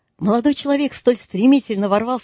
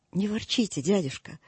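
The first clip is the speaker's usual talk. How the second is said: gentle soothing; 8 dB quieter than usual. A narrator's reading. Nephew soothing grumpy uncle.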